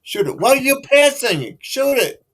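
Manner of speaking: high-pitched, exasperated hockey-fan yell; imitated yelling. Retelling the shouts heard downstairs during hockey, imitated yelling. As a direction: holler in frustration at the players. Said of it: "he would always hear him yelling".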